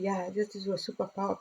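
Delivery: fast, soft whispered voice of God; God's reply. Retold or imitated. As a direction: whisper the answer like a gentle God. Voicing God's answer again.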